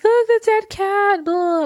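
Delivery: high, drawn-out mock wail; mock horrified cry. Showing him the dead cat, high mock horror.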